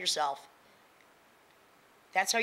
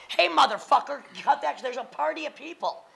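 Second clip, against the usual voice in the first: yelling Hey, motherfucker higher and louder; mock shouted retort; 7 semitones higher, 3 dB louder than usual. Telling off a harasser in a higher, louder mock yell. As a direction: bark it back like you mean it. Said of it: "just say".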